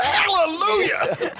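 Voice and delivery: drawn-out, high, slow joyful cry; mock hallelujah. Recalling the studio cheering when the camera worked, high drawn-out hallelujah. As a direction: stretch it out like a gospel shout of relief. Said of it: "everybody went".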